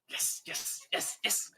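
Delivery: breathless whispered yeses; whispered delight over the package; gleeful whisper. Acted. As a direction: hiss it with secret glee. Dwarf gloats over package.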